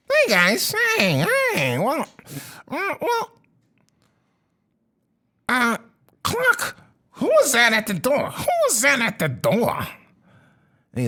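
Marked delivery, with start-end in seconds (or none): 0.1-9.9 high, fast nasal-free squeaky creature babble; a twitchy little gatekeeper, fast; goblin voice. Acted. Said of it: "you hear another voice".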